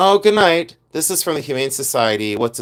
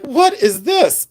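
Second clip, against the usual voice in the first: amazed; 11 semitones higher, 3 dB louder, slower than usual. Retold or imitated. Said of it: "I was like".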